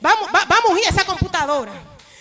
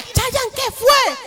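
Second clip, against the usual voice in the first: surprised question; 8 semitones higher, much slower than usual. Retold or imitated. Speaker asks coworker what happened.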